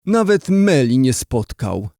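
ordinary delivery

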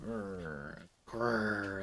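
low imitated bear growl; mock growl. Recalling the bear kill, a mock low growl.

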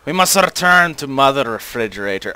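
mock Russian accent. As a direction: slip into a playful Russian accent. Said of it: "I just went into a Russian accent".

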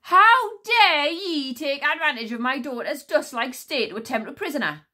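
loud furious high queen voice; outraged regal snap; snapping queen. Acted. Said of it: "snapped Queen Sycorax".

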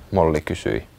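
plain reading voice

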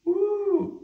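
high, drawn-out "woo"; admiring whoop. Real, not acted. Showing it's not burnt, admiring high whoop. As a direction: coo a high woo at fine work. Admiring the freshly sharpened cutter.

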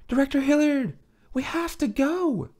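put-on high, urgent young woman; Loriana's voice. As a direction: plead with her to leave. Loriana urges the director.

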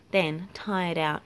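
usual speaking voice

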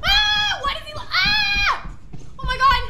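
very high, loud shrieks; screaming in panic. Real, not acted. Player fleeing the rat in the maze, panicked screaming. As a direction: shriek the words mid-scream.

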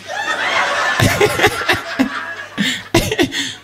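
long rising wheeze of laughter; high breathy laugh. Real, not acted. Comedian cracking up at the pupil story. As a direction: let a high laugh run and climb.

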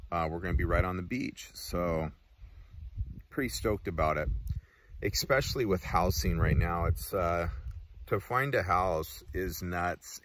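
talking around pipe stem; pipe in mouth. Real, not acted. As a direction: teeth clenched on pipe. Seen 3: Pipe stem clenched in mouth from 1.8s; held in hand at 0.6s.

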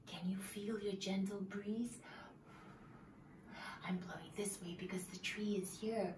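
soft whispered teacher voice; hushed breathy demo. Shown as a demonstration. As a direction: whisper it to the children. Whispering breeze demo to kids.

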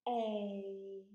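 A drawn out, gliding as it goes; long vowel A. Shown as a demonstration. Teacher shows children the long sound, A stretched out. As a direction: hold the letter name A long. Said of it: "You say it as we write it".

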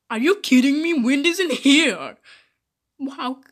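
incredulous. Pretend. Disbelieving a friend's claim.

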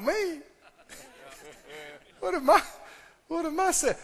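high, caught-off-guard "Me?"; startled self-quote. Retold or imitated. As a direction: play his own surprise when God asks. Retold startled reply to God.

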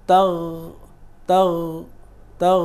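তাগ, start heavy then let it vanish; heavy then fading gh. Shown as a demonstration. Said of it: "খেয়াল করেছেন আমার উচ্চারণটা".

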